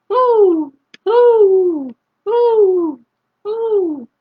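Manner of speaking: high, loud hooting; owl hoots. Shown as a demonstration. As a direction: hoot like an owl. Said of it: "He can sound like an owl".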